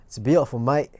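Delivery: matey Australian impression; Australian accent. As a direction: praise it in a mock Australian accent. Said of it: "that's my Australian".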